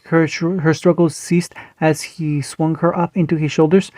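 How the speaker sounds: usual speaking voice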